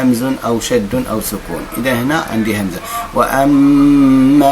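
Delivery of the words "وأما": وأما recited slowly, meem held, vowel long; Quran recitation. Shown as a demonstration. Teacher reciting the verse for students, slow. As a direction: chant وأما with the long madd. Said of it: "ثم أذهب إلى المد".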